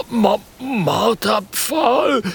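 slow, high, stammering repeat of Marterpfahl; frightened stammer. A narrator's reading. Trapper repeats the word torture stake in stammering fright. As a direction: stumble over the dreaded word. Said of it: "wiederholt Bert Brewster Bronson".

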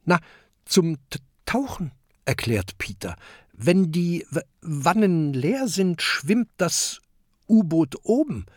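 high, halting voice with stutters on first sounds; proud little inventor tripping over his words; young inventor stammering through his explanation; stuttering Peter. Acted.

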